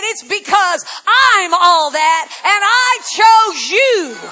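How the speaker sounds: voice of God. Retold or imitated. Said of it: "he said".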